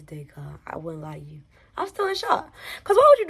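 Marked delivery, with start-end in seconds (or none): 1.8-3.3 suddenly much higher, incredulous; high-pitched disbelief. Real, not acted. Friend admitting she is still shaken, high-pitched disbelief. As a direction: squeak it out in lingering shock.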